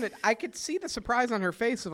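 normal talking voice

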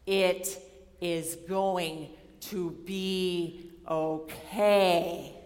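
drawn-out, patient reassurance; grandfatherly, very slow. Retold or imitated. Advisor finally reassuring her very slowly, deliberate and kind. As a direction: spell it out slowly and kindly. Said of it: "his grandfatherly way just looks at me".

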